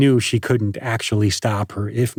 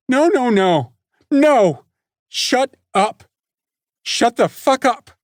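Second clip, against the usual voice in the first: much higher and louder, full-throat scream; howling scream; 14 semitones higher, 5 dB louder than usual. A narrator's reading. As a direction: scream it at the top of your lungs. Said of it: "Nikki howled at the top of her lungs".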